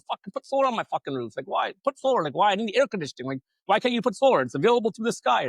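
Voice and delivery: mock rant. His own angry rant retold.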